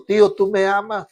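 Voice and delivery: put-on a little boy calling to his mother in the night; mild child's plea to mom; child crying out. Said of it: "Se levantaba gritando".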